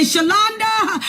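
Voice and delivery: God's voice calling Shalonda, lightly voiced; calling a name. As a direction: call her name from across the house.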